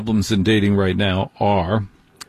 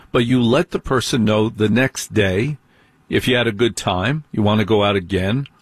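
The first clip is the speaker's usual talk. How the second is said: ordinary delivery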